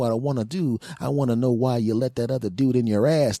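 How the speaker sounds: usual speaking voice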